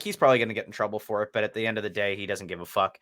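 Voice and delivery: ordinary delivery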